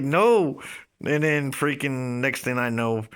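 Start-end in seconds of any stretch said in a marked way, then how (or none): 0.0-0.6 loud, high refusal; defiant shout. Retold or imitated. Refusing to leave his friend's room, loud defiant shout. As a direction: shout back stubbornly. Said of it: "And I was like".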